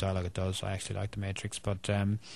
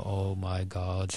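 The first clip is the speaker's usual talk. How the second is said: slow, pained oh my God; dismayed groan; 4 dB quieter, much slower than usual. Retold or imitated. Irish viewer hearing a bad accent, slow pained groan. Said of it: "they go".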